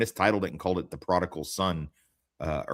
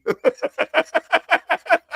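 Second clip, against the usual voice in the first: high burst of laughter; 8 dB louder than usual. Real, not acted. Laughing at the delivery guy's line.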